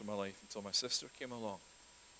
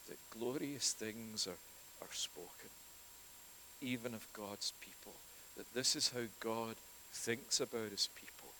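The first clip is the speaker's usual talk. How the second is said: ordinary talk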